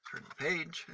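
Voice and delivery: ordinary delivery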